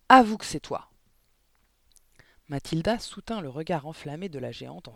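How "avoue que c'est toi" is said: high, much louder bellow; roaring. A narrator's reading. Headmistress screaming at Mathilda to confess. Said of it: "rugit mademoiselle Le Gourdin".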